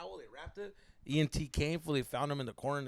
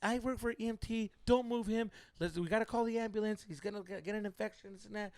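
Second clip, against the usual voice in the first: higher-pitched, hesitant bystander voice; girl onlooker; 7 semitones higher than usual. Retold or imitated. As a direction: lightly imitate a woman giving advice. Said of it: "This girl's like".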